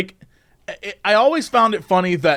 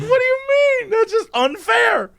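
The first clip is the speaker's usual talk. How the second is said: put-on whiny kid. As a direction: whine it like a wronged kid. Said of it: "I'm like".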